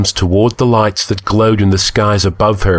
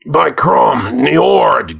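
high, gasped oath; higher-pitched gasp of recognition; 6 semitones higher, much slower than usual. A narrator's reading. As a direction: gasp it out on waking, stunned. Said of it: "gasped the Cimmerian".

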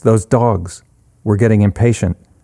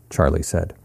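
usual speaking voice

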